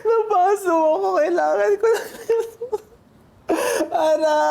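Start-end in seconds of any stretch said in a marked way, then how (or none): 0.0-1.1 high, teary pained cry. Real, not acted. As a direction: whimper from a fresh burn.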